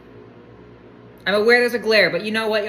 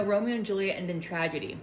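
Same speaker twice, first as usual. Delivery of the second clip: much softer, dropping away; deflated realisation; 9 dB quieter than usual. Real, not acted. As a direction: back down quietly as the doubt lands. Realises the story ends badly.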